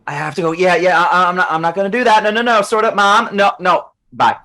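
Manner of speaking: put-on high, exasperated teen cutting mom off; flustered teenage protests; annoyed teenager. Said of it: "he sounds like an annoyed teenager".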